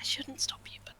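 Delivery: soft unvoiced whisper. Real, not acted. Player whispering a giving-in aside to the table. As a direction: breathe it out under your breath.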